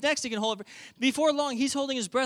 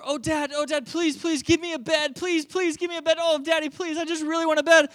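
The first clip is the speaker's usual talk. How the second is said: high, insistent mock pleading; begging child; 9 semitones higher, 5 dB louder than usual. Child begging dad for a bed, pleading. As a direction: beg Dad in a hammed-up child's whine. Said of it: "they just begin to beg me".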